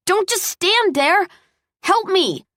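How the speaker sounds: very high, exasperated plea; urgent appeal. A narrator's reading. Squirming woman begs her friend for help, exasperated.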